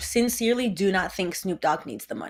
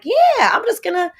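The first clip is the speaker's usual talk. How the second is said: mock [name] voice; 10 semitones higher, 8 dB louder than usual. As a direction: play the careless celebrity. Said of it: "he's like".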